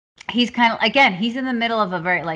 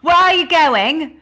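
high, loud, slow mock-British accent; hammed-up British accent; British boss; 7 semitones higher, 6 dB louder, slower than usual. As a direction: imitate a British boss calling out. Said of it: "meet his British boss".

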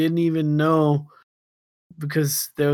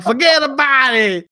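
mock Italian-American mobster voice, higher and louder; Italian New York accent; 10 semitones higher, 6 dB louder than usual. Irishman brushes off his new Irish relatives, shouting in a mock Italian-American accent. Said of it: "Now he's Italian all of a sudden".

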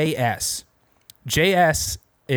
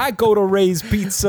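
hammed-up New Yorker spotting pizza; New York accent; 8 semitones higher, 4 dB louder than usual. Co-host joins the bit mocking New Yorkers, New York accent.

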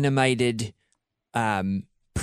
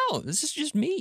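mock high voice; 13 semitones higher, 7 dB quieter than usual. Quoting own reply, high voice.

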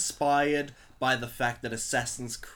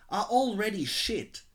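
slow, louder, sneering read; mocking read; 6 semitones higher, 10 dB louder, slower than usual. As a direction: read it out with scorn. Reading silly comment aloud.

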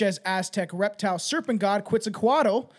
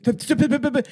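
loud, higher-pitched p-p-p sputter; sputtering lip noise; 6 semitones higher, 6 dB louder than usual. Real, not acted. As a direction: sputter out a string of p's after a tongue slip. Sputters after stumbling over a word.

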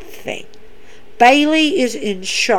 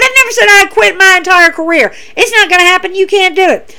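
defiant wrestler retort; 7 semitones higher, 4 dB louder, faster than usual. Retold or imitated.

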